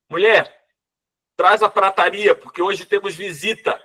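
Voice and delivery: calling to wife. Acted. Bishop calling to his wife.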